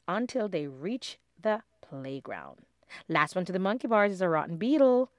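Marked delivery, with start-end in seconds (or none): 3.1-5.1 squeaky challenge. Acted. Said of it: "squeaked Lindy".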